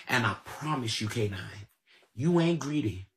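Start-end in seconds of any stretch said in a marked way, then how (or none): none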